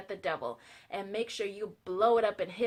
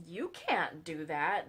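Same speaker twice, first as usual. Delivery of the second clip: slow, stretched-out mock reproach; drawn-out scolding; 3 semitones lower, much slower than usual. Ranger objects to the dynamite, slow mock scolding. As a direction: scold it slowly, imitating a shocked ranger.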